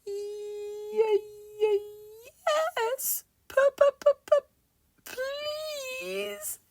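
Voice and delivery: very high, frightened stutter; quivering stammer. Acted. Mister Jelly begs the tramp's secret, quivering stammer. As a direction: stutter on each word, ending in a drawn-out squeak. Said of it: "quivered and shook and said".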